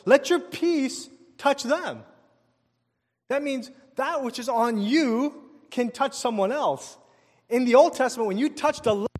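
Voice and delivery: high, loud preaching push; full preaching. Acted. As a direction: drive the point home to the crowd. Preaching the point hard.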